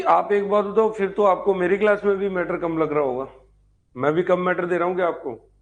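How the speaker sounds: ordinary talk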